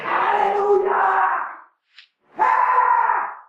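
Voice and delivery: high straining yell, voice cracking; hoarse yell with sand. Retold or imitated. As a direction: strain out a scratchy hallelujah. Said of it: "lo tiraba la arena en mi garganta".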